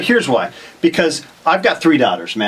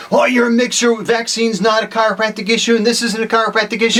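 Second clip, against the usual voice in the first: higher-pitched mocking imitation of sanctimonious colleagues; hammed-up, higher, self-righteous protest; high-horse objection; 6 semitones higher than usual. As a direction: mimic a chiropractor up on a high horse. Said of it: "chiropractors get on their high horses, and they're like".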